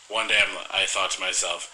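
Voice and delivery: normal talking voice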